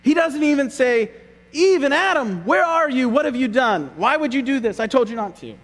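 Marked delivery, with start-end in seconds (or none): 1.5-3.1 higher, louder, accusing question; demanding call. Pretend. God voiced in hypothetical rebuke. 3.1-5.5 raised, scolding questions. Pretend. God voiced in hypothetical rebuke.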